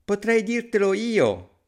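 high, drawn-out little call; tiny character shouting high. A narrator's reading. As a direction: pipe up from down on the ground. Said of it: "gridò subito Pollicino".

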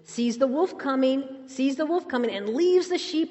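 ordinary talk, nothing marked

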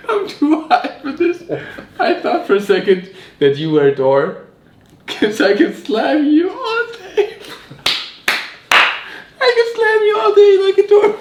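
laughing, giddy stoned voice; stoner. Real, not acted.

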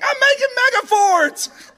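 high, loud, drawn-out squeak with a laugh; a freaked-out little boy squealing his excuse; child squeals back at mom, high and loud; scared kid. Retold or imitated. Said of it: "I was like".

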